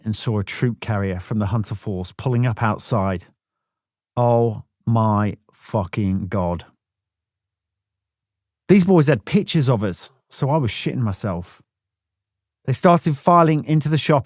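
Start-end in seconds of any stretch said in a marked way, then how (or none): none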